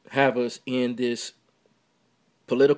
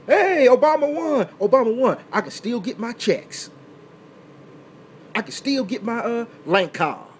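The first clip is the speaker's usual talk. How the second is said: much higher and louder, a gleeful caricature cheering; mocking gleeful voice; 7 semitones higher, 5 dB louder than usual. Vlogger parodies a voter cheering about checks, mocking caricature. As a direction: lampoon a voter celebrating the win. Mocking celebrating welfare recipient.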